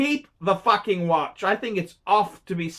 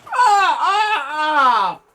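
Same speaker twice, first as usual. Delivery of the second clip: high pained cries; mock anguished wail; 6 semitones higher, 5 dB louder, much slower than usual. YouTuber groaning at bad polishing, hammed-up wail. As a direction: cry out in mock agony.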